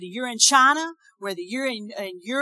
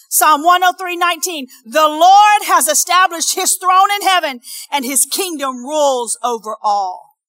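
loud, raised declaration of the verse; ringing pulpit reading; emphatic scripture preaching; 6 semitones higher, 10 dB louder than usual. Acted. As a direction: proclaim it to the whole congregation.

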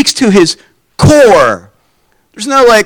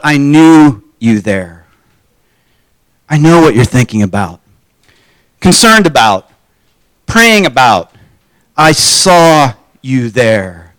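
tender Jesus; 7 semitones lower than usual. Acted. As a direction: speak as if you see right into him. Jesus speaks to Nathanael tenderly.

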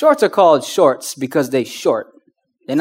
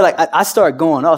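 ordinary talk

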